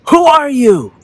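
high, loud shouted question; calling out. A narrator's reading. Perplexed boy calls to the unknown voice, shouting. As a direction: call out high to an unseen stranger. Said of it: "Now he's getting angry".

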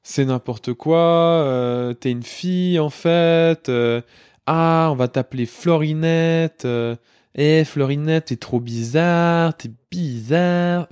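slow, drawn-out mock child taunts; imitating teasing pupils. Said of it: "et puis ils m'ont dit".

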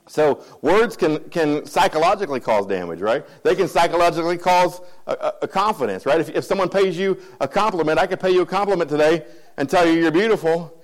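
usual speaking voice

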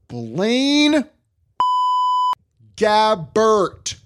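drawn out in disbelief; fed-up sports pundit dragging the name; exasperated. Real, not acted. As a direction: say the name like it hurts. Exasperated naming of the player.

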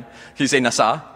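coaxing repeat. Shown as a demonstration. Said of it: "Can you try it again?".

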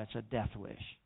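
ordinary talk

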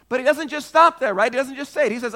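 usual speaking voice

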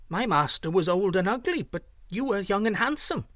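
high-pitched, flattering goose. A narrator's reading. The gander soothes his changed master, flattering. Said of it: "in a tone of flattery".

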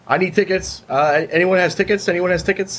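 pushy ticket tout. Retold or imitated. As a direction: call out like a scalper hunting tickets. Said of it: "It's like".

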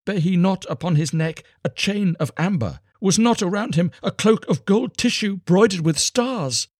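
high, loud, insistent questioning; impassioned high-pitched questioning; urgent cry. A narrator's reading. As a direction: cry out, building in intensity. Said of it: "she cried".

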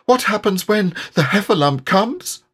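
high, small and trembling; trembling Piglet. A narrator's reading. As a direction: quaver it out like a frightened piglet.

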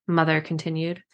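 usual speaking voice

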